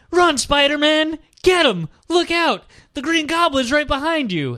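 high, loud heckling yell; mock drunken screaming. Drunk audience member screaming at Spider-Man, mock panicked yelling. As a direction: holler warnings at the stage, hammed-up. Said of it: "me screaming like".

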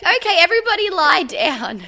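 loud, high call to a class; teacher's instruction. Retold or imitated. Teacher calling the class to lie down, loud and high. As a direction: announce it to a room of kids. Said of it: "I was like".

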